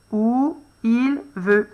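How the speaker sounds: very slow, word by word; slow dictation. Shown as a demonstration. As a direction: dictate it slowly. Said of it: "Tu mets en pause pour bien regarder". (dictée très lente)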